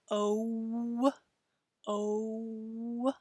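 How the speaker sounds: English "O" gliding into a W as the lips close; "O" with a w-glide at the end. Shown as a demonstration. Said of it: "a little bit of a W sound at the end".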